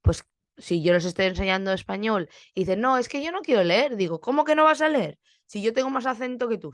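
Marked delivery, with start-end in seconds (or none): 2.8-4.0 lightly imitated reluctant pupil, higher pitch; mock a pupil's protest; alumno reacio. Imita a un alumno que se niega. 4.2-6.7 high-pitched mock disbelief; indignación fingida. Regaña en broma al alumno reacio.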